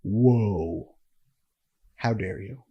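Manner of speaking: low, hushed mock indignation; a dropped voice of outrage; lightly hammed-up how-dare-you; mock offended. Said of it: "And he's like".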